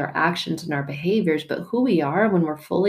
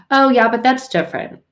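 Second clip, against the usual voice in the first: raised, whiny protest; defensive excuse; 6 semitones higher, 6 dB louder than usual. Retold or imitated. Person excusing Netflix over God, defensive. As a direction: brush off the point, defensive.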